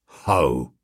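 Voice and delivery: very low suspicious bobby's ho; london policeman. Acted. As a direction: grunt it like a stolid constable. Policeman grunts.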